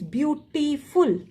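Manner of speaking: "beautiful" slowly in parts, beau, ti, ful; split syllables. Shown as a demonstration. Teacher introducing the next word, "beautiful" broken into syllables. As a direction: separate each syllable of "beautiful". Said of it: "is complete one syllable".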